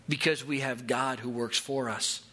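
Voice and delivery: ordinary talk, nothing marked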